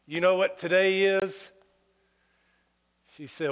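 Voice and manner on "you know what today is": proud. Retold or imitated. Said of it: "I said all proud".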